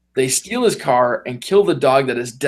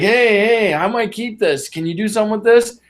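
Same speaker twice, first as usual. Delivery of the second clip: put-on higher, cocky kid voice; cocky mob son; 7 semitones higher than usual. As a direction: play the spoiled rich kid showing off the car. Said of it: "And he's like".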